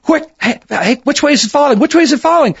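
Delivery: high, loud urgent demand; urgent question. Retold or imitated. DJ Danny on air pressing Hank, urgently. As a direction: press him fast for which way.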